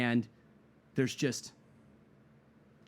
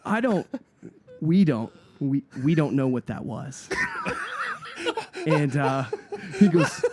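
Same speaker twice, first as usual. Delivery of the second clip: laughing, higher and louder; laughing through the quote; 7 semitones higher, 5 dB louder than usual. Real, not acted. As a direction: crack up while quoting the manager. Laughing retelling the manager.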